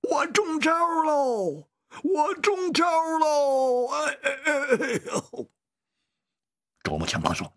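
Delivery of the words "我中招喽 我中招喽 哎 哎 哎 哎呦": high, drawn-out cry of distress; loud wail. A narrator's reading. Man cries out that he's been hit, loud wailing shout. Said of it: "他大声地喊着".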